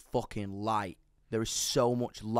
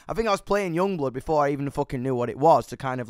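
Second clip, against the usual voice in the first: usual speaking voice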